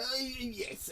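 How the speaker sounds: high-pitched, over-the-top posh British voice; mock upper-crust British accent, high-pitched. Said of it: "Prince Philip style".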